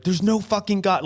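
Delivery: mock angry yell. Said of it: "I was just so angry".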